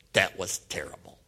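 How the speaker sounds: hushed, low private thought; dejected whisper. Retold or imitated. Shy teen thinking after reading aloud, dejected near-whisper. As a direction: breathe it to yourself, mortified.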